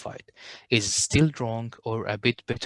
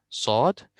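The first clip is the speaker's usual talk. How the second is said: ordinary talk, nothing marked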